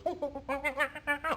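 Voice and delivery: monkey laugh. Acted.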